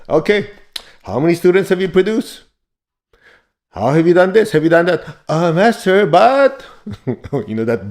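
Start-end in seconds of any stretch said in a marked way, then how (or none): 0.1-5.0 higher, louder rapid-fire questioning; acted master firing questions at a student; interrogating master. Master quizzing the student on results, mock interrogation. 5.3-6.6 high, loud mock protest to the master; hammed-up pleading objection, pitch shooting up; protesting student. Cornered student answering the master back, mock protest.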